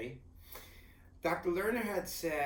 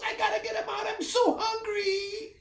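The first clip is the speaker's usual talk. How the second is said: much higher, loud, slowed cry with laughter; laughing shout; 6 dB louder than usual. Retold or imitated. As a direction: shout it mid-push, half laughing. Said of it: "she goes".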